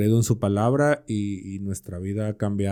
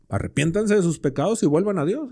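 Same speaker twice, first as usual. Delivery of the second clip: lightly acted preacher's appeal, higher pitched; preaching apostle; 7 semitones higher than usual. Peter telling the crowd to repent, lightly acted preaching. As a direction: raise the pitch as if urging a crowd. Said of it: "cuando está predicando ahí Pedro".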